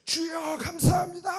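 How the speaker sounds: high, strained mock prayer cry; fervent crying out. Calling to the Lord in thanks, fervently acted. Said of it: "더 간절히 막 부르시게 되는 거예요".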